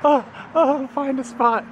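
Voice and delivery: imitating a little kid's voice; lightly voiced small child; voicing the kid. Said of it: "His little fingers are like".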